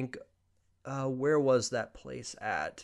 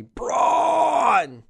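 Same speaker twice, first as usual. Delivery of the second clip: high, drawn-out roar of BRAUN; booming name roar; 13 semitones higher, 7 dB louder than usual. Acted. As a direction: bellow it long, like a wrestling hype call. Mock correction, imitating a wrestler's roar.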